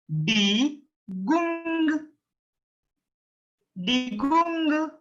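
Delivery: die Gung held high and long, "dee-goong"; syllable breakdown. Shown as a demonstration. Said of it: "try to pronounce it".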